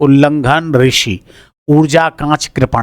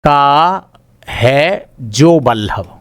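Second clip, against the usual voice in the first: very slow, each word set apart; 3 semitones lower, much slower than usual. Shown as a demonstration. Teacher slowly breaking down का है जो Ballabh for the class. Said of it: "Ballabh माने स्वामी".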